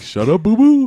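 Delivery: cartoon character voice.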